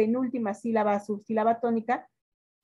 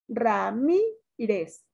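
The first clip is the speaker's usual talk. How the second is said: "Ramírez" very slow, MI stretched and raised; stress on MI; much slower than usual. Shown as a demonstration. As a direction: sound out "Ramírez", dwelling on "mí". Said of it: "Sílaba tónica". ("Ramírez" muy lento, alargando MI)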